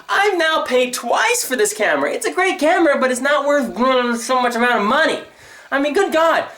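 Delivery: high-pitched exasperated complaint; indignant rant. Real, not acted. Complaining about paying twice for a camera, indignant. Outrage at being charged twice.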